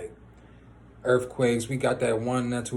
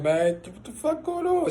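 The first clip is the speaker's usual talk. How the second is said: much higher, slow, stretched-out panicked cry; panicked yell; 13 semitones higher than usual. Retold or imitated. Things falling off the banister, panicked high cry. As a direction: drag it out in pure alarm. Retold panic inside the house.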